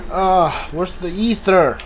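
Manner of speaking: usual speaking voice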